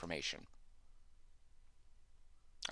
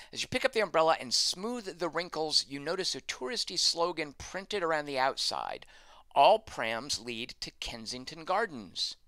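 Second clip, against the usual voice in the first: higher-pitched reading of game text; story narration; 5 semitones higher, 4 dB louder than usual. Acted. Reads game text aloud.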